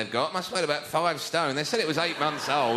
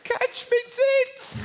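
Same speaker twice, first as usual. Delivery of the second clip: high, slow shrieked mock yell; 3 dB louder, much slower than usual. Mocking the bystander filming, high slow shriek. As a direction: stretch it out like a panicked shout.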